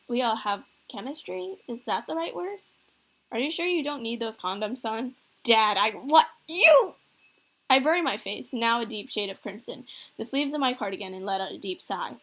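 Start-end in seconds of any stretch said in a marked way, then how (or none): 5.4-7.0 sputter, then burst out in embarrassed outrage; flustered outcry. Acted. Son teased about condoms, sputters.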